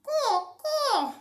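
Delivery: high-pitched parrot caws; parrot squawk. A narrator's reading. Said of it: "said the parrot".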